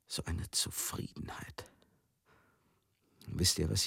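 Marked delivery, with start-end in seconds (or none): 0.1-1.7 hushed, breathy whisper of contentment. Acted. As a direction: breathe the word out as if savouring it. Savouring rare contentment. (geflüstert, voller Zufriedenheit)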